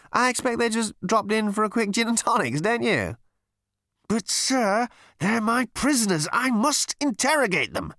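high, put-on voices traded back and forth; high character voices. A narrator's reading. Captain and number two bickering in high voices. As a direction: play the silly exchange high.

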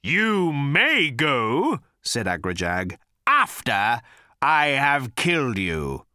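slow, loud monster threat; menacing creature voice. Acted. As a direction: drawl the threat slowly. Agrajag threatening.

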